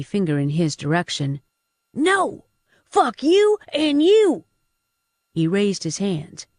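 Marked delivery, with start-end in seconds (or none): 1.9-4.4 high, loud, drawn-out cursing; angry outburst. A narrator's reading. Thug turning on his own gang, angry outburst. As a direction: lash out at each of them, high-pitched.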